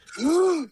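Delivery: sharp high imitated gasp; a hammed-up audience gasp; mock gasp. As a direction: gasp like a shocked crowd. Audience reacts to harsh sentence.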